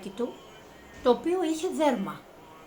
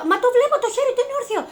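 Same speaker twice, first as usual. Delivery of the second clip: frantic insisting; 12 semitones higher, 8 dB louder, much faster than usual. Retold or imitated. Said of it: "Μου λέγανε".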